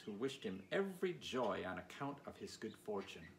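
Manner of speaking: ordinary delivery, nothing marked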